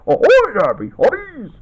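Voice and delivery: high, rollicking pirate call. Acted. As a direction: hail the crew like a hammy pirate, ho-ho-ing first. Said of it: "Good pirate impression".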